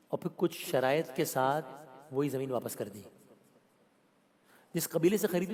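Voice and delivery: usual speaking voice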